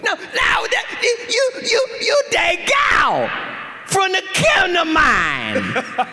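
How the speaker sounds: very high, slurred screech; meth-head woman. Retold or imitated. Woman identifies the actor from his TV episode, high screech. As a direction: insist in a slow, mangled falsetto shriek. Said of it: "She goes".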